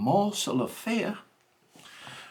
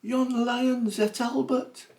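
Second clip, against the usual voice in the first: shouting to Mother; 14 semitones higher, 4 dB louder than usual. Acted. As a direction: call out in alarm to his wife.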